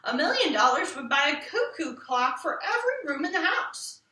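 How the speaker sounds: usual speaking voice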